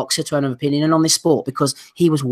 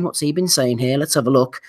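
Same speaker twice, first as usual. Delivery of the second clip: normal talking voice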